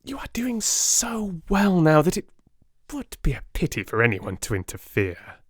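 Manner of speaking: dry mock praise, high and breathy; politely sneering sarcasm; sarcastic. A narrator's reading. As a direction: flatter them with open scorn. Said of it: "a world of sarcasm in his voice".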